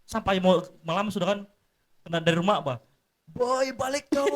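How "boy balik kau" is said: high-pitched call across the yard; mock parental holler. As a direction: call a child home from play, voice raised high. Parent calls child home in story.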